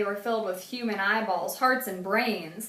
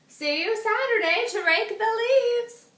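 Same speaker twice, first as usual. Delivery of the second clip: put-on old woman voice; 13 semitones higher, 5 dB louder than usual. A narrator's reading. Mrs Tibble welcomes Arthur warmly, high old-lady voice. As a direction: offer it sweetly like a lonely grandmother.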